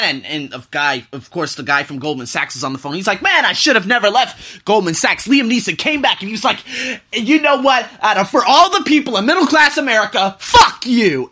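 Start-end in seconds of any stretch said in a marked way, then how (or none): 3.2-11.3 retold telling-off. Liam Neeson tells broker off.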